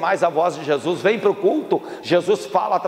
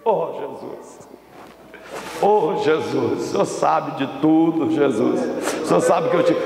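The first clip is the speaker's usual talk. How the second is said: mock tearful plea. Peter breaks down before Jesus, acted tearful plea. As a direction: act a touch of weeping. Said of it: "Aí Pedro começou a chorar".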